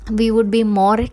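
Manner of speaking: ordinary talk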